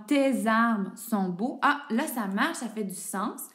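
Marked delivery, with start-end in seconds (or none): 0.1-1.6 slow test sentence. Shown as a demonstration. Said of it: "Par exemple".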